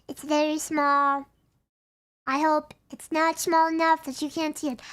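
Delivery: ordinary delivery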